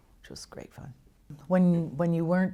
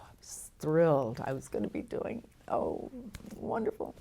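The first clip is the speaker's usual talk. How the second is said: hushed, thrilled whisper; breathy thrilled whisper; 4 dB quieter than usual. Retold or imitated. Actress recalling the thrill of landing the part, whispering. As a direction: breathe it out in delight.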